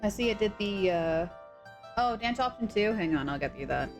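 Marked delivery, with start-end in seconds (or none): none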